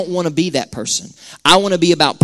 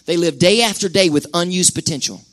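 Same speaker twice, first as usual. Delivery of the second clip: ordinary talk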